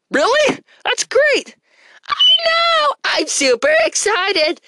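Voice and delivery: high, loud and thrilled; excited. Pretend. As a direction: squeal with delight. Character hears good news.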